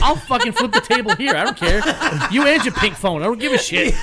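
hammed-up fake fury at a friend; mock angry outburst. Mock fight over pumpkin beer.

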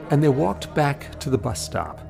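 usual speaking voice

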